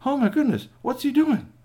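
high-pitched astonishment; amazed exclamation. Acted. Watchers seeing Squeaky Toy bow to trolls, amazed. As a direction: exclaim high, as if unable to believe it.

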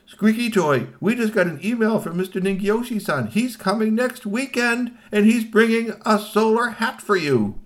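gasp, then high, gushing news; excited announcement. Acted. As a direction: announce it loud and high like a wonderful surprise. Family breaks exciting news to toy.

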